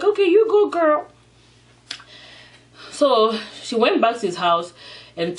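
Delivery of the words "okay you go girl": high, sing-out hype cheer; playful, high-pitched friend's encouragement; cheering her on. Acted. Reader cheers on the woman choosing love, high and playful.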